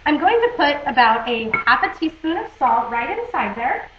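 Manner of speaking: ordinary delivery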